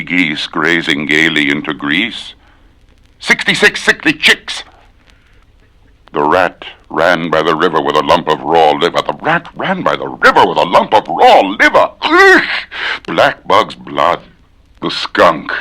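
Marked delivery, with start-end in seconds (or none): 3.2-4.6 squeaky high chick voice. Shown as a demonstration. Squeaky chick voice for the twister. 12.0-12.5 high exasperated ugh; frustrated grunt. Real, not acted. Annoyed at flubbing the twister.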